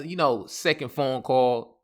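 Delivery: ordinary talk, nothing marked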